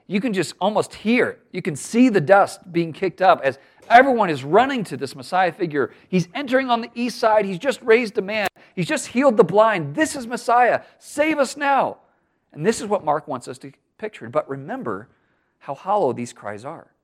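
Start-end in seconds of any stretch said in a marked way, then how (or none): 6.1-12.0 excited crowd. Retold or imitated.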